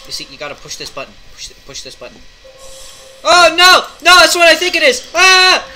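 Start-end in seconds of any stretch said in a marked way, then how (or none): 3.2-5.7 high loud panicked shrieks; panicked screaming. Real, not acted.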